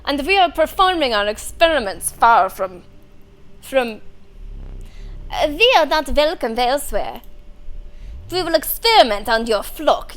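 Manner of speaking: loud, hammed-up German doctor; bad German accent. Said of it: "I do a very bad German accent".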